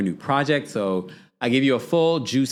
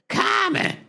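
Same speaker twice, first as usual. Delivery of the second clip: much higher trilling call of Common. Retold or imitated. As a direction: squeal the name with a trill in the voice. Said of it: "trill to her voice".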